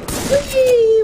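excited squeal. Real, not acted. Gleeful cry in game.

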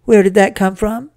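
high, loud alarmed question; startled mother. A narrator's reading. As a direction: demand it in sudden alarm. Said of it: "with a startled look".

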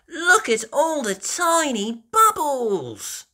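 put-on high, slow little-girl piggy voice; Peppa voice. Peppa showing George the bubbles, high and delighted. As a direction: squeal it like a delighted little pig. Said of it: "says Peppa".

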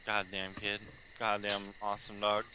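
normal talking voice

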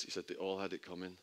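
usual speaking voice

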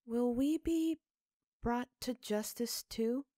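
put-on high, slow, innocent child question; a small girl asking her father, rising; child Sophie's voice. A narrator's reading. Sophie asks father about justice.

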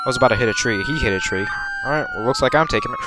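ordinary talk

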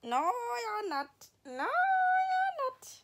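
dog voice. Pretend. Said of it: "She's like".

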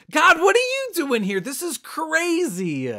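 Abraham startled to find God in his yard, a high astonished cry. Acted. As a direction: jump out of your skin at the surprise. Abraham startled by God's visit.

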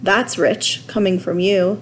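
usual speaking voice

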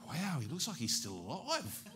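high-pitched, hushed-to-rising wonder; amazed. Retold or imitated. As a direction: marvel at what you see, pitch swooping up. Retold amazement at open casket.

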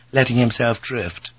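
ordinary delivery

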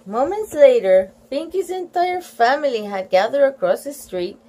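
put-on lively children's story reading; story read-aloud. A narrator's reading. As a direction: read it to children, lifting the characters' lines.

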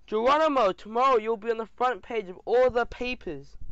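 high, loud excited call; shouted announcement. A narrator's reading. Reporter calling to Geronimo as the audience leaves, shouted. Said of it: "the reporter shouted".

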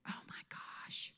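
slow whisper; breath-only aside, drawn out; hushed mock awe. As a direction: whisper it slowly as if amazed. Mock-awed aside between identical queens.

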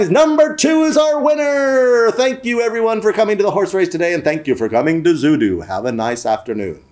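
high triumphant call winding down to a sign-off; announcer's winner call. Retold or imitated. Announcer declaring the winner, then thanking crowd, falling from high cry. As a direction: start high and stretched, descend steadily to normal.

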